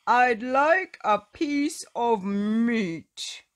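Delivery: slow, loud booming giant voice; loud booming demand. Acted. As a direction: boom it out, big and slow. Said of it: "boomed Mister Noisy".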